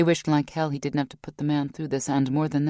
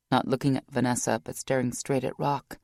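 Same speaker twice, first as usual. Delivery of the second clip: plain reading voice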